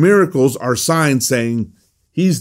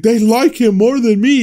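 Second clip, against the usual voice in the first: petulant emperor; 10 semitones higher, 3 dB louder than usual. Retold or imitated. Teacher mocking the Gladiator emperor, high and whiny. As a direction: whine it like a spoiled ruler who lost the crowd. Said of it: "he's like".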